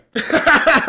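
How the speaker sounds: loud high burst of laughter; big real laugh. Real, not acted. Laughing at crying over subscribers.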